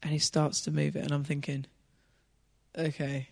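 tense hushed narration. Real, not acted. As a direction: drop the voice for suspense.

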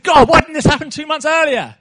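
a son shouting his frustration up at God; angry outburst at God. Retold or imitated. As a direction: pour out the anger at God, pitch up and push the volume. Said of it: "I was even angrier with God".

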